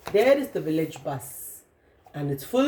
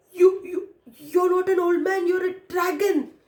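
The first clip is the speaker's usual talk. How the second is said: much higher, stuttering in fright; frightened fisherman stammers at the dragon, much higher; terrified stammer; 10 semitones higher than usual. Acted. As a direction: stammer it out, scared stiff. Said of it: "he stammered".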